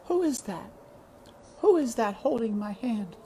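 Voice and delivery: dying mother asking who holds her hand; faint dying mother. Acted. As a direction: drift away, unsure who's there.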